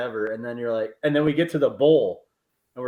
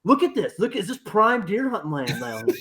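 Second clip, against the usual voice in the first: higher, eager look at this; excited pointing out; 10 semitones higher, 5 dB louder than usual. Retold or imitated. Seth distracting scared friend with the view, excited and higher. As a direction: sell the view to distract him.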